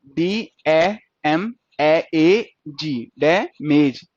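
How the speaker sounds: slow, separate sounds "डी ए एम ए ए जी", then "डैमेज" in two beats; spelling out sounds. Shown as a demonstration. Teacher telling students to go by sound, spelling "डैमेज" out slowly. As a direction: sound out "डैमेज" one piece at a time, drawn out. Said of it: "sound पे जाना है".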